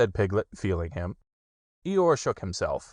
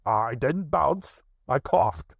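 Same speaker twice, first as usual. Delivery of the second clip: slow, cross tiger voice; a touch cross; cross Tigger; 5 semitones higher, slower than usual. Acted. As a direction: Tigger insisting, annoyed. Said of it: "said Tigger crossly".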